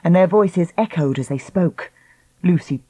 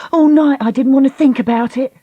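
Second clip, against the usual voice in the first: raised, high distressed voice; crying out; 8 semitones higher, 5 dB louder than usual. Acted. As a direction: pour it out loudly. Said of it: "she cried".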